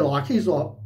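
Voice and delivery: usual speaking voice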